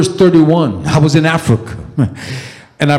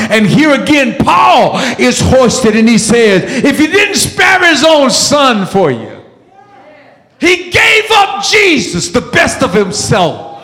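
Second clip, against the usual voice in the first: full-force preaching; 7 semitones higher than usual. Acted. Preacher proclaiming God gave his son, full-force preaching. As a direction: cry it out, then ease on the last phrase.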